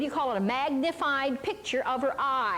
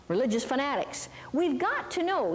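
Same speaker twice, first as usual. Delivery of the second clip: ordinary talk, nothing marked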